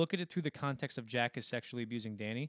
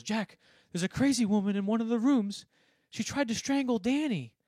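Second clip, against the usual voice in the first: high, loud mock panic; imitated panicked wife; 10 semitones higher, 10 dB louder than usual. Wife runs in to warn husband, imitated high panicked cry.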